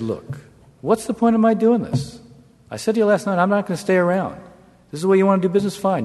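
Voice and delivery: rising anger, pitched well up; angry. Retold or imitated. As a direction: push back hard, exasperated. Angry retort in a standoff.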